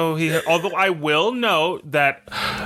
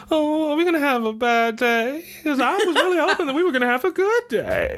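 mock nervous; 12 semitones higher than usual. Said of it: "I was like".

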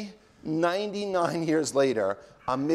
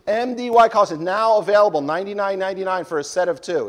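higher, louder salesman pitch; mock ad read; 5 semitones higher, 4 dB louder than usual. Host pitching joke collar stays like an ad. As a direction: sell it like a cheesy infomercial.

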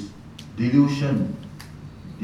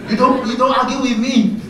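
much higher, louder indignant snap; angry retort; 12 semitones higher, 9 dB louder than usual. Retold or imitated. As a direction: put the boy in his place loudly. Monk snapping at the boy.

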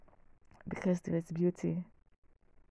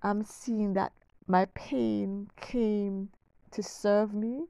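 ordinary talk, nothing marked

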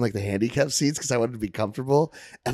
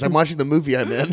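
ordinary talk

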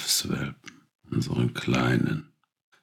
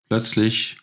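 sudden; 8 semitones higher, 4 dB louder than usual. A narrator's reading. Sudden event in story.

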